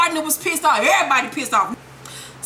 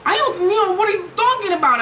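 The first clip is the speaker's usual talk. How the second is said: shocked; 6 semitones higher than usual. Retold or imitated. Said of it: "So Angela's shocked".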